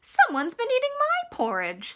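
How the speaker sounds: high, different-timbred mama bear voice. Acted. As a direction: play the mama bear, put out. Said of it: "said Mama Bear".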